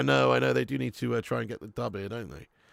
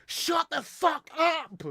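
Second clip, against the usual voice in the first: imitating a guy's furious yell, higher, slow; retold angry yell; much slower than usual. Guy at the front telling chatting girls to shut up, mock yell. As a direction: bark it like the stranger in the cinema. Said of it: "some guy literally just goes".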